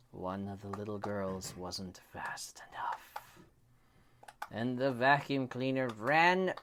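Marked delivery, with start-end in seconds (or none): 0.1-3.0 suspense whisper. A narrator's reading. Suspense in bedtime story.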